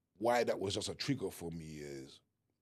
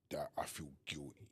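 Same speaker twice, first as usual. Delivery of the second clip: guilty words whispered; 3 semitones lower, 8 dB quieter than usual. Real, not acted. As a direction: breathe it out in a whisper. Confessing grief, breaking off.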